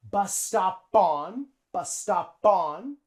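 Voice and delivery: "bus stop on" slowly, the P carried onto "on"; linked P. Shown as a demonstration. Teacher shows the P linking to the O, slow linked demo. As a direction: say "stop on" like "stop-pon". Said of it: "the P in stop links on".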